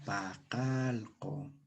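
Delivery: Pacalco drawn out very slowly, each syllable apart; slow model. Shown as a demonstration. Teacher repeats Pacalco slowly for class. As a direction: spell Pacalco out in sound. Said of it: "Repitan conmigo".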